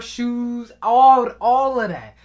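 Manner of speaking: ordinary talk, nothing marked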